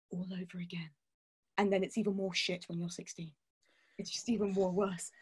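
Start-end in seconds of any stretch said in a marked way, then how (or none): 0.1-0.9 unvoiced, much softer whisper; hushed, dreading whisper. Real, not acted. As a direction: drop to a whisper for the punchline. Whispered aside about puberty.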